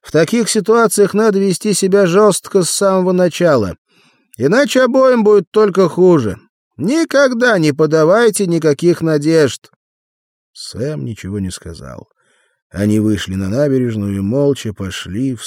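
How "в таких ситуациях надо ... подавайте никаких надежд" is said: high, loud, insistent advice; emphatic lecturing. A narrator's reading. Arnold lecturing Sam on being firm, loud and high. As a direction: lay down the rule at full voice.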